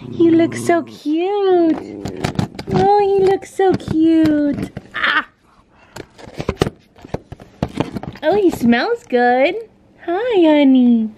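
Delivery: slow, swelling, adoring gush; gushing pet talk. Real, not acted. Owner fussing over freshly groomed dog, gushing baby talk. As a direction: gush over the dog, words stretched long.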